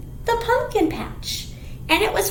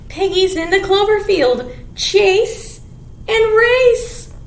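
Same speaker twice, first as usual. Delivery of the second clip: put-on reading to children; 5 dB louder than usual. Teacher reading the picture book to kids. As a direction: read each phrase out with relish.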